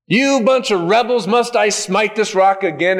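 high-pitched, exasperated rebuke; angry Moses. Acted. Moses losing his temper at the rebels, angry. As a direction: scold a crowd in rising temper. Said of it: "Moses got angry".

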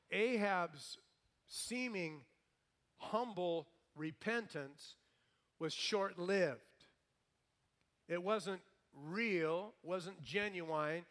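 slow, weighty stress; slowly hammering the point home; slow emphasis. Real, not acted. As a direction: draw each phrase out slowly. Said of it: "preacher slows for emphasis".

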